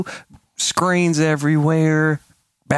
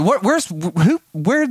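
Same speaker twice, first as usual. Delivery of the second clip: high, stammered string of questions; flustered questioning; 9 semitones higher than usual. Retold or imitated. Dad asking who set off the alarm, flustered. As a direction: imitate a flustered man hunting for answers. Retelling his frantic search for culprit.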